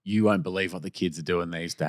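put-on low, soft old-man grumble; mock retiree, pitched well down; old husband. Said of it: "And he would have gone".

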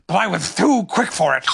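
curious creature with its own timbre; Tigger. A narrator's reading. Tigger learns what attacked him, loud character voice. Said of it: "said Tigger".

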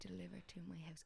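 soft, private self-reminder; saying it to oneself. Retold or imitated. Speaker showing how they remind themselves which word to use, said softly to self. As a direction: say it quietly, as if to yourself. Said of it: "say that to myself".

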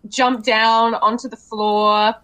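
ordinary talk

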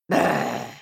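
low rolled growl of fury; angry witch's brr. A narrator's reading. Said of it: "said Baba Yaga".

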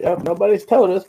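normal talking voice

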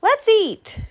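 high, rising excitement; high-pitched eager groundhog; excited announcement. A narrator's reading. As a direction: announce good news to a crowd. Groundhog excitedly tells his lie.

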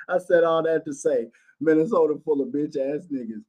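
a hint of Wells quoted back with a grin; imitating Wells. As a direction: replay his line as a mild send-up.

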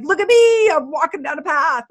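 mock someone making a big drama of walking; mock stressed-out drama. Stressed-out person showing off a simple walk, in hammed-up overwrought drama. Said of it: "I'm making fun".

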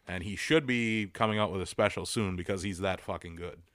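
ordinary talk, nothing marked

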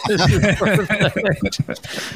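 slow, high, through laughter; cracking up mid-sentence; laughing. Real, not acted. Laughing at the glitchy audio.

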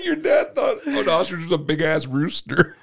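slow words squeezed through laughter; real giggling recap; laughing while talking. Real, not acted. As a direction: barely get it out while laughing. Still laughing at the story.

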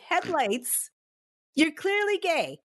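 hammed-up scoff at an obvious lie; mock incredulous. Teasing the man about his girlfriend, mock disbelief. Mocking reply to the boyfriend.

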